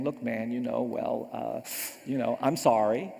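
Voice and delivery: low, halting mumbled excuse; reluctant apology. Retold or imitated. Boy made to apologize to the child he laughed at, reluctant. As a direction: imitate a boy forcing out an apology.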